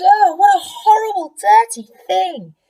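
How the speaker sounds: disgusted yell. Acted. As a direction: yell in horror. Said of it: "They yelled".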